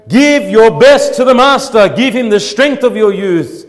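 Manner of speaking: loud, high rallying cry from the pulpit. Acted. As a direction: cry it out as a preacher's exhortation. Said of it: "the voice of Abel crying out".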